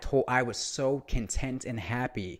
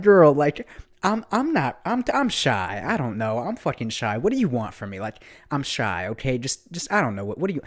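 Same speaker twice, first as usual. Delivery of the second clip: mock shy guy; 2 semitones higher, 4 dB louder than usual. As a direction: play the coy shy boy, overdone. Playing shy to pushy waitress.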